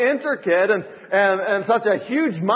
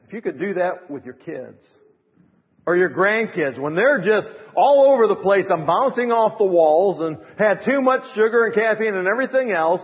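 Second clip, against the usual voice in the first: ordinary talk, nothing marked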